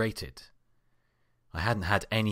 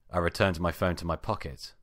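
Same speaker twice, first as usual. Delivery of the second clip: ordinary delivery, nothing marked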